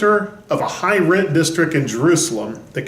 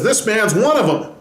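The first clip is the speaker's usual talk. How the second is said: put-on accusing servant girl; 7 semitones higher than usual. Retold servant girl accuses Peter.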